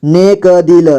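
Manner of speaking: नेक दिल with क given its own vowel; syllable by syllable. Shown as a demonstration. Teacher breaking down नेक दिल for learners, syllable by syllable. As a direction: sound out नेक दिल piece by piece. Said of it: "जोड़ जोड़ करके".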